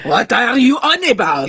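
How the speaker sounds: put-on drawn-out, indignant French accent; angry French accent. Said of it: "I can't do a very good accent".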